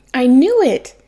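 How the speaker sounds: loud, high burst of triumph; loud high gotcha exclaim; excited exclaim. Acted. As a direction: blurt it out, sure you were right. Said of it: "Superman exclaimed".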